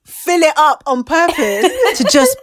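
loud, high laughing reminiscence; loud delighted laughing. Real, not acted. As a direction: gush through laughter. Loves the grand finale.

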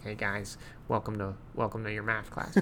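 nasally teacher voice, pinched and quiet. Retold or imitated. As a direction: squeeze it through the nose like a dull math teacher. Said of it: "He had a really nasally voice".

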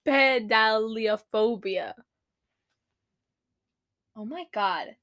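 ordinary delivery

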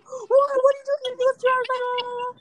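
very high mock panic; high-pitched babbling, imitating shock; imitated freak-out. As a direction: squeal it like a startled friend, hammed-up. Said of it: "he, like, freaked out".

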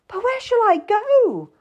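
very high, distressed plea; crying out. A narrator's reading. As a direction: cry out in despair. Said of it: "I cried out".

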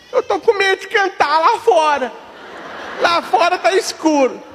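put-on high, whimpering child; almost crying at the dark; scared little boy. Little boy at the dark bathroom door, high frightened whimper.

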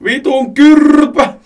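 high, loud, drawn-out battle cry; shout. A narrator's reading. Joni charging with nunchakus, drawn-out shout. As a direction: yell it out slowly as you charge. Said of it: "huusi juostessaan".

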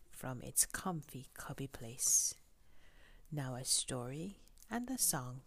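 soft, sleepy bedtime reading; bedtime story. Acted. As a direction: read gently to a sleepy child. Bedtime book read softly.

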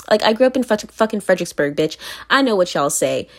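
usual speaking voice

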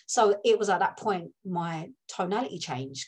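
ordinary delivery

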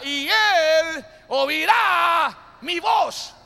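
slow, high, shouted declaration; booming shout of faith; loud proclamation. Acted. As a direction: proclaim it slowly, each word ringing.

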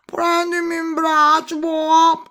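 high, loud toad cry; toad shouting. A narrator's reading. Toad calling after the running princess, high croaky shout. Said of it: "gridò".

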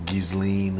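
"Ghislaine" with a hard G and a long E in the second syllable; hard-G, long-E pronunciation. Shown as a demonstration. Offering another version of her name, "Ghislaine" as GIZ-leen. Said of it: "they pronouncing her name".

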